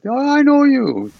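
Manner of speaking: put-on high, delighted old lama; fond lama insisting he knows you; Rinpoche's voice.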